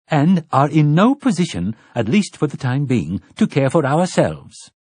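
high, loud and declaiming; ringing tones. Acted. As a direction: proclaim it like a haughty matron. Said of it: "in ringing tones".

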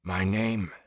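forced out through chattering teeth; shivering, teeth chattering. A narrator's reading. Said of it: "forced between chattering teeth".

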